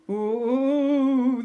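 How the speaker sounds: high, loud excited coo. A narrator's reading. Molar sisters thrilled by the pirate girl, high coo. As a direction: coo with delight. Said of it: "the Molar sisters cooed together".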